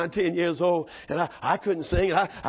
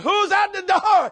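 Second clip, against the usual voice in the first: put-on drunk father hollering high; town drunk; 13 semitones higher, 6 dB louder, slower than usual. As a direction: yell it like a drunk daddy. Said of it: "Daddy said".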